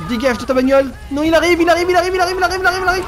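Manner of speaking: high, loud panicked babble; panicked yelling. Real, not acted. Player panicking as police car arrives, high frantic yell.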